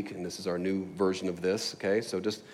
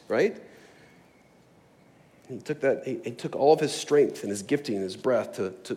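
usual speaking voice

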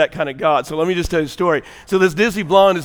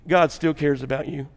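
ordinary delivery

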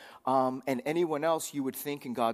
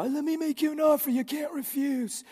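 high mobster impression; smirking wise guy; 13 semitones higher than usual. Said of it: "It'd be like a wise guy voice".

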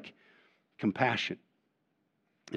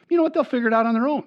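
complacent excuse; 11 semitones higher, faster than usual. Retold or imitated.

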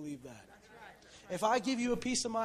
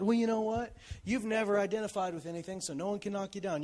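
ordinary delivery

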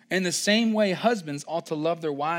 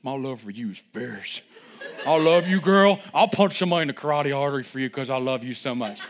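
mock fierce lover; 3 semitones lower, 4 dB louder than usual. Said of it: "I'm like".

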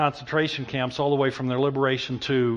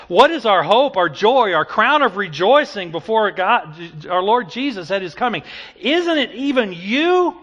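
loud, high-pitched impassioned sermon; full-force preaching; 9 semitones higher, 10 dB louder than usual. Real, not acted.

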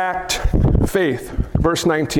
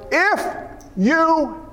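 doubting plea; 4 semitones higher, much slower than usual. Acted. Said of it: "Do you ever talk like that to God".

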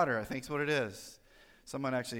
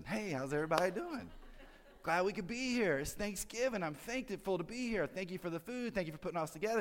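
higher, soft holiday sweetness; cheerful different person; 4 semitones higher, 3 dB quieter than usual. Acted. Parent greeting family on Thanksgiving morning, suddenly cheerful. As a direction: gush gently, pitched up. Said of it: "you're a different person".

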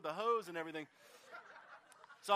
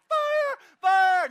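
high, loud panicked yell of fire; frantic shouting; 11 semitones higher, 14 dB louder than usual. Retold or imitated. Neighbor lady yelling as the tree burns, panicked loud shout. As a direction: shout it like a woman running in circles. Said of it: "just going".

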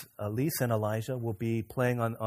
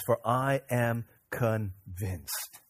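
"For I am convinced" drawn out very slowly; slow emphatic reading; much slower than usual. Shown as a demonstration. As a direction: linger on every word of "For I am convinced". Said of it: "That word convinced means".

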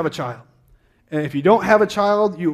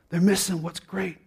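ordinary delivery

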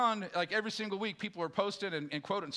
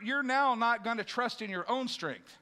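ordinary delivery

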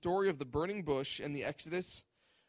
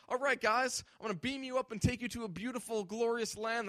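fast, higher, chirpy tour-guide promise; mock breezy God; 7 semitones higher than usual. Preacher mocking a God who beams people away, breezy and chirpy. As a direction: sell it like a cheesy sci-fi rescue.

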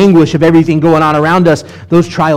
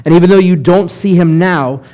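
ordinary delivery

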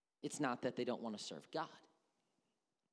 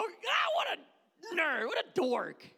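much higher, loud, slow jeering shout; mocking laugh-off; 11 dB louder, slower than usual. Congregation mocking the flattery as nerdy, in a high jeering shout. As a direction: scoff at it loudly like a schoolkid. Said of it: "we laugh at that and go".

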